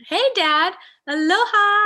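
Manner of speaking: bright-pitched, exuberant call; calling out hello. Real, not acted. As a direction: sing out a hello to your dad. Calling a greeting to dad in chat.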